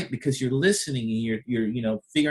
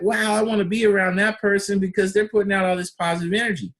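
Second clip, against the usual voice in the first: impressed; 6 semitones higher than usual. Retold or imitated. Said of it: "And you're like".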